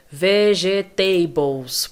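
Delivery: Vegetables drawn out slowly as veh-zheh-TAY-bols; common mispronunciation. Shown as a demonstration. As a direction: stretch out a wrong, stressed TAY in Vegetables. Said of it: "erro comum". (Vegetables errado, bem devagar, com TEI forte)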